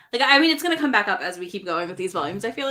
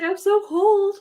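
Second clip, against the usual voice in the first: very high, louder whimpering shiver; shivering complaint; 12 semitones higher, 5 dB louder, much slower than usual. Retold or imitated. Ao without his fur to the group, high shivering whine. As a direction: whine it high like a freezing little creature. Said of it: "He's just like".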